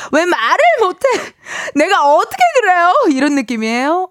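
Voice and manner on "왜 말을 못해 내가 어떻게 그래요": drama quote. Retold or imitated. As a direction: deliver it like a TV drama hero. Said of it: "명대사를 한번 따라해봤습니다".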